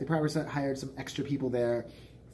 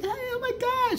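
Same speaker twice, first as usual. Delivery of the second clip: a much higher, squealing mock-girly greeting; mock dancer squealing hello; squealy girl impression. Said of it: "She's like".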